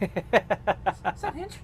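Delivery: high, slow, loud mom voice; imitating mom. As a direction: squeal it out like a mom. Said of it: "every time that my mom saw somebody".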